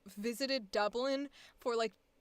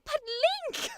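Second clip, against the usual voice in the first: British accent catchphrase, pitched up; exaggerated posh British call; Zelda, fake British accent. Shown as a demonstration. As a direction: kick into the British accent on a princess's cry. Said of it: "I can't not do it without going".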